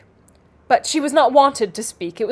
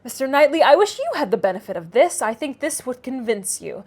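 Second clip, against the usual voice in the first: ordinary delivery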